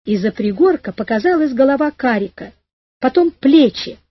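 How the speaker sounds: ordinary delivery, nothing marked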